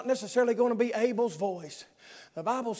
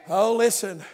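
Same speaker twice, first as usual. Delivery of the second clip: ordinary talk, nothing marked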